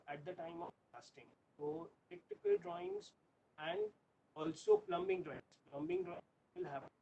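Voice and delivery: very faint, distant lecture voice; lecturing far from the microphone; barely audible. Real, not acted. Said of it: "your voice is very slow".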